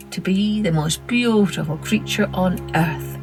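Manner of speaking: usual speaking voice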